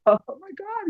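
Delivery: high giddy oh my God; delighted squeal. Retold or imitated.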